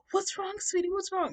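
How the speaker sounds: high-pitched fussing, worried woman; concerned woman. Retold or imitated. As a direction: imitate an anxious, comforting lady.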